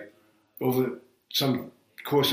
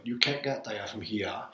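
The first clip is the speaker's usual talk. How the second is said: put-on old-style Maine accent, softer; 6 dB quieter than usual. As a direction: imitate a Maine local, R's dropped. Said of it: "Every up there was like".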